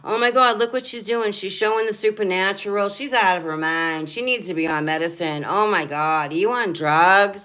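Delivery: loud, high mock-outraged gossip; mocking critics. Mimicking critics who call her crazy, shrill mock outrage. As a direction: sneer at the people spying on her. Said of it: "I know what they're doing".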